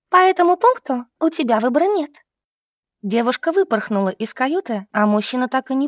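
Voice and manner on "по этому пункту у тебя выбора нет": high, sly announcement. A narrator's reading. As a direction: tell him with a cunning smile. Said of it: "Коварно улыбнувшись, Лиза сообщила". (с коварной улыбкой, высоко)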